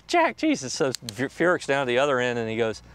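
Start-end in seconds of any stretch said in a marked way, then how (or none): 0.1-0.7 very high, retold exasperated outburst; exasperated protest. Protests Jack's teasing.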